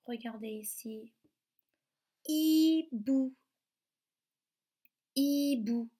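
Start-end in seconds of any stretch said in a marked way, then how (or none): 2.3-5.9 "hibou" said high and loud, syllables separated; careful sound demonstration. Shown as a demonstration. Teacher has children listen for ou, careful two-syllable "hibou". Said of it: "Vous entendez le ou dans le mot".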